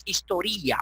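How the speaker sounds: historia as histo-RÍ-a; shifted stress. Shown as a demonstration. Teacher supposing a tilde, saying histo-RÍ-a. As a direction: break the diphthong of historia, stressing RI. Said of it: "pronunciáramos que fuera así".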